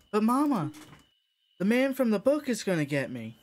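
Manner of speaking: put-on high, loud whining child; high-pitched small child protesting; tot voice. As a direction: plead with mom as a worried little kid. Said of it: "good voice for this tot".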